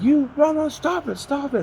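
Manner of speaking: high-pitched mock protesting woman; yelling Russian woman. Russian woman telling him to stop, imitated high and pleading-scolding. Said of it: "came up to me and started yelling at me".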